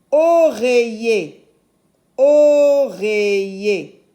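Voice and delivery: oreiller slow and loud, long first O; sounded-out word. Shown as a demonstration. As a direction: stretch the O that opens "Oreiller". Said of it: "Ça s'appelle oreiller". (oreiller lent et fort, O très allongé)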